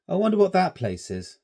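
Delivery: a curious scout staring into the distance; young Stanley wondering aloud; schoolboy voice. A narrator's reading. Boy Stanley voiced in story.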